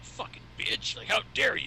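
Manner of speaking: whispered curse, then a high outraged how dare you; mock indignation. Friend mimicking how he snapped at the driver, hammed-up outrage. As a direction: ham up his offended outrage. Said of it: "you probably came off like".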